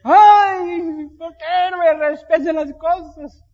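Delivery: high, loud, whiny lament; mock whining cry. Pastor mimics wailing over a missing soda from the fridge. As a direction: wail like a child robbed of a treat.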